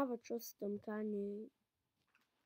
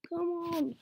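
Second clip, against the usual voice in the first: normal talking voice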